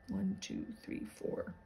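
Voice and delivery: very soft, near-whispered count; barely voiced, to yourself; hushed counting. Real, not acted. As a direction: count under your breath. Counting holes to self.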